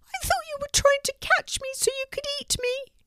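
very high anxious squeak; high-pitched frightened mouse. A narrator's reading. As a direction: blurt it in a tiny mouse voice. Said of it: "squeaked the mouse".

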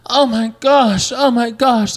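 high, slow, dramatic mock shock; dramatic gasp. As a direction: gasp it out like fake horror. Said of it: "dramatically were like".

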